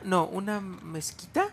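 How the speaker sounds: usual speaking voice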